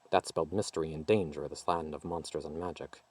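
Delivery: usual speaking voice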